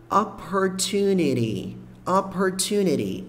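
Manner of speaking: opportunity said slowly, every syllable clear; slow pronunciation demo. Shown as a demonstration. Teacher models the word for students, slow and careful. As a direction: spell out opportunity slowly for the learner. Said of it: "Esse T é bem sopradinho".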